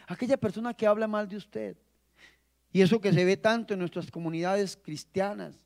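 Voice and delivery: normal talking voice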